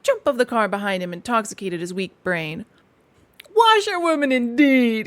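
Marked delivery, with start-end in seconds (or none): 3.6-5.1 loud, high scornful shout; reckless shout. A narrator's reading. Toad throwing off his disguise, shouting recklessly. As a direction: fling the word back with a shout. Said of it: "he shouted recklessly".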